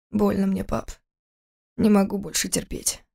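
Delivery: subdued, pained confession. A narrator's reading. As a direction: admit you can't bear it. Son admits his pain. (с тихой болью)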